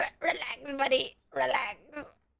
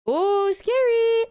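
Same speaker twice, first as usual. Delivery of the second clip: high, slow, loud sarcastic ooh; mock scared; 9 semitones higher, 5 dB louder, much slower than usual. Teasing chat after a fake neck break, mock scared. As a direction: ham up fake fear.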